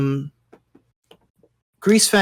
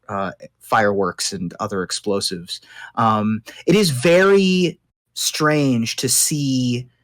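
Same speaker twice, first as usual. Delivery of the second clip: usual speaking voice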